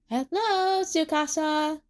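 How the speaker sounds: high little toy greeting; talking bunny plushie. A narrator's reading. Bunny plushie greets a startled Tsukasa, high toy voice. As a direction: greet like a stuffed rabbit come to life, high.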